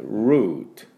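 ルート as English "root", relaxed drawn-back R; long ルート with a bare final T; 舌を引いたR. Shown as a demonstration. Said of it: "力を抜いて舌を落とす感じで、". (ルート を力を抜いたRで英語の root に)

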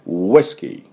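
ウイスキー with a held, rounded ウ; held-back W. Shown as a demonstration. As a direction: build up, then release ウイスキー. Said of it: "この溜める感じです". (溜めてから出すウイスキー)

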